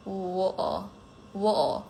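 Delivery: British "Water" with the middle T dropped; British accent. Shown as a demonstration. Streamer answering a viewer's dare, British accent. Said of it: "Can you say water in a British accent".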